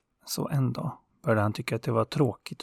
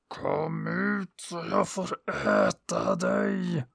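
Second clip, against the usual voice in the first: slow, higher, drawn-out monster voice; slow hungry sun monster demanding its prey; menacing sun monster; 5 semitones higher, much slower than usual. Acted. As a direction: let the hungry sun growl out a threat, slowly. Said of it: "ropade han".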